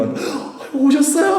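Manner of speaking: very high, hammed-up gushing welcome; mock-fawning greeting, laughing; 과장된 영접. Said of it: "막 이래요?". (어린이를 귀빈처럼 호들갑스럽게 맞는 시늉)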